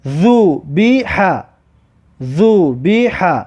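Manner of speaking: ذبیح said slowly as /zubiha/, clear final vowel; slow Arabic reading. Shown as a demonstration. Teacher drilling pupils on a Quran word, slow recitation. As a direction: draw out ذبیح with each vowel full. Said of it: "ذو-،".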